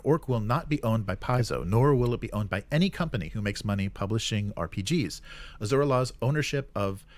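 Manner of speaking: ordinary talk, nothing marked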